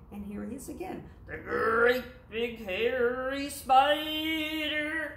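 lightly voiced big hairy spider. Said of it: "you have a great big hairy voice".

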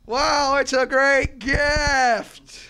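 loud, high, very slow wow; dazed awe. Retold or imitated. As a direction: drag it out, stunned. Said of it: "we're like".

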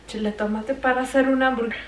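normal talking voice

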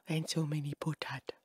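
hushed, whispery marvelling; awed whisper. Real, not acted. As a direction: whisper it over the loot. Marvelling at loot.

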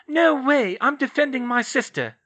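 put-on high, loud young girl refusing; defiant refusal. Astoria refusing her sister, high defiant voice. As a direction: dig in your heels.

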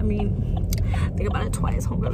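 hushed warning whisper. Real, not acted. Warning her baby girls, whispered and voiceless. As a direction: lean in and whisper the advice, voiceless.

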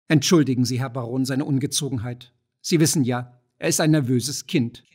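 ordinary delivery, nothing marked

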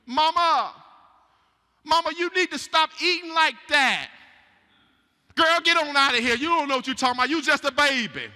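put-on high, loud child's plea then a dismissive mother; playful skit of a child and a mother; child warning mama. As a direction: voice the little one, then brush her off.

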